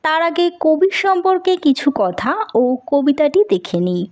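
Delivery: ordinary delivery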